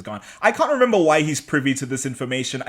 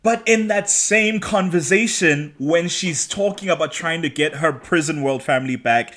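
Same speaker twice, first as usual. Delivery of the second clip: ordinary talk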